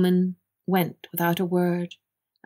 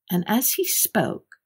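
plain reading voice

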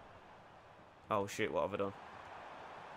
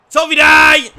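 excited shout; shouted name; 11 semitones higher, 19 dB louder than usual. Real, not acted. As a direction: yell the name, much higher and louder. Urging his striker.

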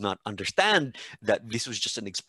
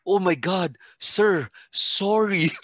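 higher, slowed, apologetic imitation; mock embarrassed student; 8 semitones higher, 5 dB louder, slower than usual. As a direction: drag out the sorry in a put-on girl's voice.

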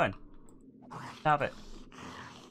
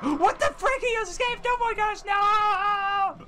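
panicked shouting; 8 semitones higher, slower than usual. Real, not acted. Monster escaped the trap.

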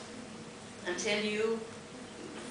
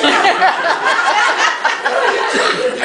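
high, loud, laughing; can hardly finish for laughing; real laughter at the tourists staring at the glaciers; 11 semitones higher, 16 dB louder than usual. Real, not acted.

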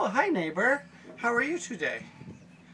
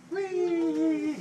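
excited whee; 10 semitones higher than usual. Real, not acted. Playful exclaim.

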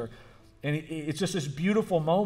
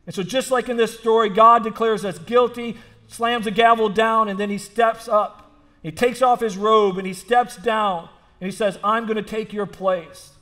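raised, emphatic sermon voice; higher-pitched, insistent pulpit delivery; impassioned preaching; 7 semitones higher, 6 dB louder than usual. Real, not acted. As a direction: preach it with force, driving each phrase home. Preacher builds from story into sermon.

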